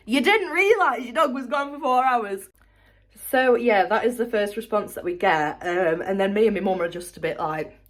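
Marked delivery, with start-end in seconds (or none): 0.0-2.5 incredulous question through real laughter; real laugh, higher with disbelief. Real, not acted. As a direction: laugh at how absurd it is. Disbelief at the owner's answer.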